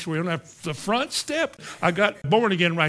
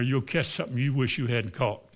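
ordinary talk

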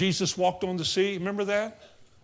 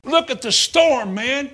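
scoffing sailor; 5 semitones higher, 5 dB louder than usual. Retold or imitated. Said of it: "That's what they would say today".

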